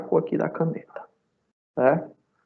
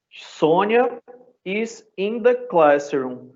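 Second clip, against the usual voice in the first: slow dictation; 6 semitones higher, much slower than usual. Shown as a demonstration. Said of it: "teacher reading example sentence".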